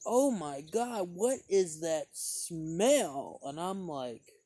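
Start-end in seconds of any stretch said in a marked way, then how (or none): 0.1-3.4 slow, drawn-out, higher mock disgust; imitating a disgusted woman catching a smell; lady's disgusted exclamation. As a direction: stretch it out like an appalled lady. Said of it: "this lady goes".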